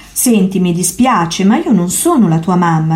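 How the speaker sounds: ordinary delivery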